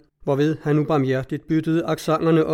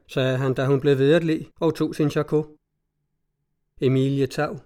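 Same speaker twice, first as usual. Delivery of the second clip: plain reading voice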